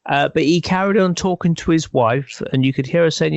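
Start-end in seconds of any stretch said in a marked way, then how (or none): none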